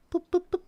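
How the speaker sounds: high mock beeping boops. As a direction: chirp them out like a cartoon sound. Mock sound effect for scene.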